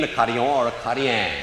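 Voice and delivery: ACADIAN in French, ah-kah-dee-on, then ah-kah-dee-an; French pronunciation. Shown as a demonstration. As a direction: say Acadian and Acadien the French way, open ah, nasal endings. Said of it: "in French, you pronounce the word".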